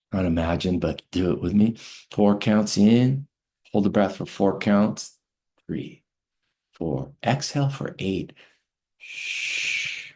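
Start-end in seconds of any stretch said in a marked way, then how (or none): 2.1-8.3 calm teacher guiding a breathing exercise; guided breathwork. Acted. 9.0-10.1 soft drawn-out shushing sound; long shush. Shown as a demonstration. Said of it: "making a shush sound".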